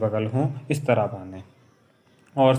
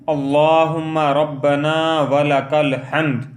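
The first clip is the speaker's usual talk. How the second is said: slow, sung recitation, a little louder; melodic recitation; 2 semitones higher, 4 dB louder, much slower than usual. Shown as a demonstration. Teacher showing the words said upright, slow chant. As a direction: chant it slowly with a gentle melody.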